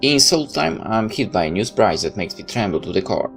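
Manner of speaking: ordinary talk, nothing marked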